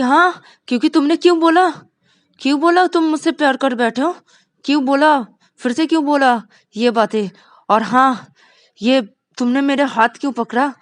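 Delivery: loud, high repeated why; angry berating. Acted. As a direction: scold him for saying it. Rani berates Vikas. (डाँटते हुए सवाल)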